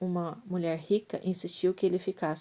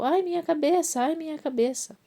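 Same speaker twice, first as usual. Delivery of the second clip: high pained cry fading on the repeat; boy crying out; 10 semitones higher, 4 dB louder than usual. A narrator's reading. Boy in the field cries to his father, pained high shout. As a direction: cry out in sudden pain, then weaker. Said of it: "o menino gritou".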